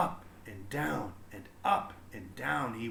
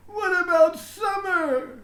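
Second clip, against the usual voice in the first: put-on high, loud child's voice calling; a kid brother protesting from the doorway; little brother calling out; 9 dB louder than usual. A narrator's reading. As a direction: call out like an upset little boy. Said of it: "her brother called".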